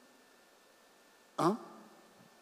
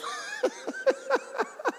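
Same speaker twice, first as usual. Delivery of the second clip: high laugh. Real, not acted. Laughs at own joke.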